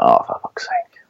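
breathy whispered curse under the breath; muttered whispered swear; exasperated whisper. Retold or imitated. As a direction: whisper it in disgust. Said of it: "I was like".

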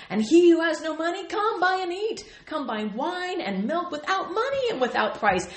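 high, loud, eager Greek aunt; Aunt Vula voice. Retold or imitated. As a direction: read it like a pushy Greek auntie. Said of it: "I hear Aunt Vula's voice".

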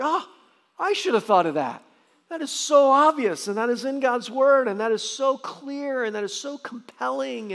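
high and loud, bursting with delight; amazed exclamation. Retold or imitated. Pastor reacting to a great sermon, high loud excitement. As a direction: gush like a preacher hearing a sermon he wishes he'd written.

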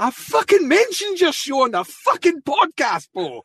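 high, slow mock scream of rage; reenacting yelling at the phone in traffic; retold scream. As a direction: shriek it at the phone in fake fury. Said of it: "I was screaming at my phone".

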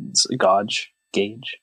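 usual speaking voice

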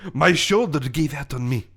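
put-on French-accented protest; aggrieved French athlete. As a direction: defend yourself as a wronged Frenchman. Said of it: "my French accent".

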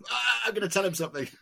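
high, fast mock old man giving in; imitating the father. The interviewee's father after a long silence, high fast imitation. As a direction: play the silent father finally cracking. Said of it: "and then he'd just go".

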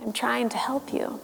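unvoiced soft whisper. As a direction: whisper it close and gentle. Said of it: "Gandalf leans in".

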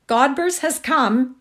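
announcing. A narrator's reading. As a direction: call out the arrival. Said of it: "announced Sadie".

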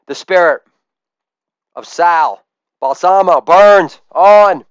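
ordinary talk, nothing marked

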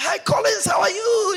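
hammed-up jolly pastor hello; pastor's greeting. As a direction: sing out the hello.

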